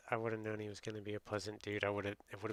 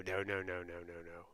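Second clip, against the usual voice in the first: ordinary talk, nothing marked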